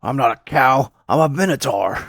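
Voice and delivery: hammed-up outraged creature; indignant minotaur. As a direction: protest like an offended beast. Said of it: "he's just like".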